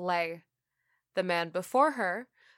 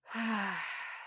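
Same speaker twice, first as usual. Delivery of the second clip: long, soft smoker's exhale; drawn-out exhale; 6 dB quieter than usual. Acted. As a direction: let the breath out slowly. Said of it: "A drawn-out exhale".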